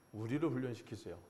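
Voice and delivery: normal talking voice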